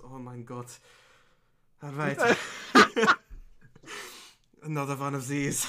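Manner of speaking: a hint of an exasperated gnome; new gnome voice. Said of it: "I'm gonna change the gnome's voice".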